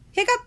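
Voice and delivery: high gasped hiccup; gasping hiccup. A narrator's reading. Jonah's hiccup cuts him off, a high gasp.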